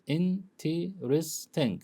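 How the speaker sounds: "interesting." stretched slowly into its four syllables; slow syllable demo. Shown as a demonstration. Teacher slowly sounds out "interesting." to show its four syllables. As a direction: drag out "interesting." so each syllable is clear. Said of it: "of four syllables".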